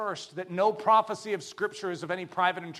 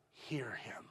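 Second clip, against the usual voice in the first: hushed whisper of the command; 6 semitones lower, 13 dB quieter than usual. Acted. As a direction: breathe it to their soul. Preacher drops to whisper.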